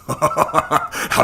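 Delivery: slow, laughing question. Acted. Rebecca laughing as she asks Connor when they leave.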